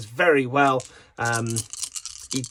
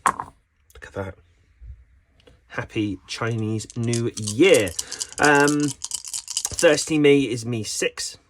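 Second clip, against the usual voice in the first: usual speaking voice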